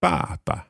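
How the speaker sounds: Papa said high and falling; repeating baba. Shown as a demonstration. Learner echoing father in Chinese. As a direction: copy Papa with a falling first syllable. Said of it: "爸爸。".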